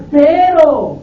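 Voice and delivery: loud, sudden burst on PERO; loud preaching pero; shouted pero. Real, not acted. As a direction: hit the word pero hard like a turn. Preacher punches key word.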